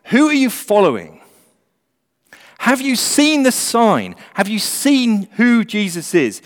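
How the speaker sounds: fiery, urgent preaching; doomsday street preacher. Acted. Preaching at full force.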